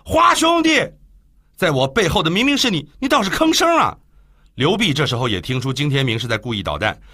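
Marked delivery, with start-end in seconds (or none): 0.0-4.0 hurried call. Acted. Song calling his silent friend, urgent higher call. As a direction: call out anxiously to the man behind. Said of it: "连忙叫道".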